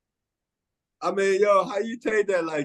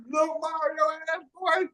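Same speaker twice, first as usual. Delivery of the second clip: laughing; 10 semitones higher, slower than usual. Real, not acted. Teasing a Mario-like guy.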